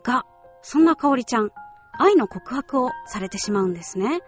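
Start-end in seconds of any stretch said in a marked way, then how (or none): none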